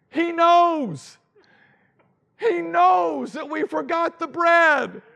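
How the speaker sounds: high, loud and slow, in a fake panic; mock panicked shout. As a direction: cry out like a frightened disciple. Disciples' panic acted out.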